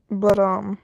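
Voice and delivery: ordinary delivery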